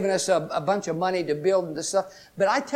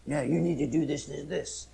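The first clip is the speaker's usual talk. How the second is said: a low, softer tempting voice; voice of flesh; 4 semitones lower, 7 dB quieter than usual. Retold or imitated. The flesh tempting a believer, low and soft. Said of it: "your flesh is saying".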